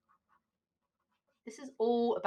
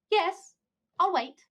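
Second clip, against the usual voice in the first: loud on phone; 10 semitones higher, 5 dB louder, slower than usual. Acted. Waiting on the phone.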